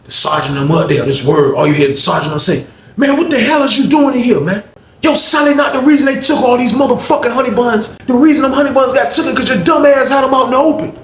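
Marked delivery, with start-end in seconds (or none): 3.0-11.0 retold scolding. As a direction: scold him like a rookie. Sergeant scolding Honey Bun Man.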